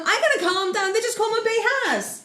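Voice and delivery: high, indignant fan rant; worked-up rant. Real, not acted. Fan defends Zhongli from haters, worked up. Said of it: "you kept telling me to calm down".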